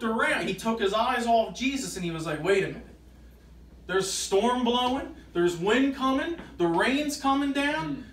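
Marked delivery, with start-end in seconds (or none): 2.4-8.0 slow, higher, rising worry as the storm sinks in; mild panic. Retold or imitated. Peter on the water noticing the storm, mildly panicked. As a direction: voice Peter's alarm, drawn out and raised.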